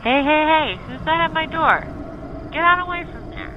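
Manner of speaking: high, loud challenge into the dark; calling out. Acted. Smitty shouting at whatever scratches at his door. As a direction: shout at the unseen thing. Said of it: "Smitty called out".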